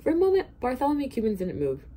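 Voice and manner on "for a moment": ordinary delivery, nothing marked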